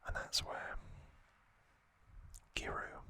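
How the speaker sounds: usual speaking voice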